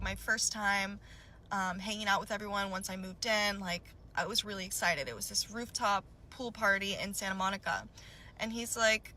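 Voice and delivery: usual speaking voice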